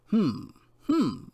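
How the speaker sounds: two throat-clearing hums, the second pitched high; self-important doctor's "hmm, hmm"; pondering hum. A narrator's reading. Doctor clears his throat before answering the lord, pondering hums. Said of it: "cleared his throat and stroked his beard".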